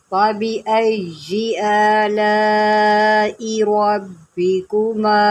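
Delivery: slow, drawn-out tajweed chanting; measured melodic Quranic recitation. Shown as a demonstration. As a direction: recite slowly, stretching each vowel. Recitation continues.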